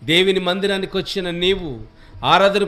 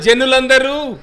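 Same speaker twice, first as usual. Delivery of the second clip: జనులందరూ said out loud and strong; proclaimed; 7 semitones higher, 10 dB louder, slower than usual. Shown as a demonstration. Pastor showing the young reader how to proclaim జనులందరూ loudly. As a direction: boom out జనులందరూ for the whole hall. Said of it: "చెప్పాలి".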